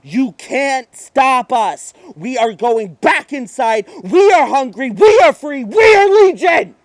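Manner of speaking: hissing menace swelling to a triumphant cry; demon chorus. Acted. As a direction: speak as the many demons, rising to a roar. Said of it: "I can hear them say".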